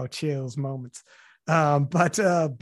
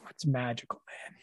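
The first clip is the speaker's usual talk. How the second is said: hushed and lower, in quiet awe; awed hush; 4 semitones lower, 10 dB quieter than usual. Retold or imitated. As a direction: let the wonder drop the voice. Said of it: "you're like".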